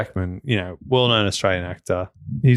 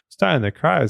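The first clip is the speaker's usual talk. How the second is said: put-on Australian accent; 6 semitones higher than usual. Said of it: "or if you're Australian, you go".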